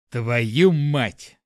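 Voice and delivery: loud furious curse; angry outburst. Acted. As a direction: explode in anger. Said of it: "не вытерпел Петрович".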